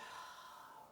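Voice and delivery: long unvoiced exhaling sigh; soft demo exhale sigh; releasing sigh. Shown as a demonstration. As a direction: let the energy out in one breath. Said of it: "releasing that energy".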